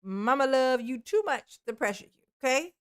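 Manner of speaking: ordinary talk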